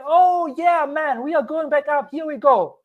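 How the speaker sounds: high, eager mock hype; mock excited cheer. Crypto YouTuber mimics a greedy buyer, mock excited. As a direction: imitate a euphoric buyer cheering. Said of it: "as it's going back up and you feel like".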